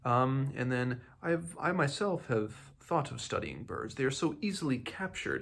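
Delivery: a hint of a knowing, insinuating old priest; Priorites voice. Reader imitating Priorites needling Morganus, lightly voiced and sly. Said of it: "he's kind of like".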